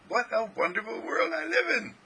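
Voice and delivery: high, wondering exclamation; delighted self-talk. Acted. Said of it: "you're whispering to yourself".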